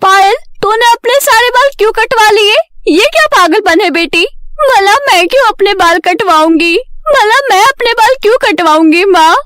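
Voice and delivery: high-pitched, dismayed family exchange; shocked exclaiming. A narrator's reading. Parent and Payal argue over her cut hair, shocked.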